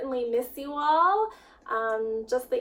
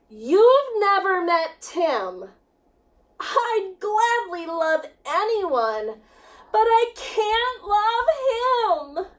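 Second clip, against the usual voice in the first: whiny protest; 6 semitones higher, 5 dB louder, slower than usual. Acted. Child protests to grandma.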